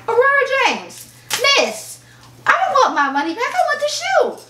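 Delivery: high, whiny mock plea for the shoe; exasperated plea. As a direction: beg like a kid denied a toy. Retold pleading email to owner.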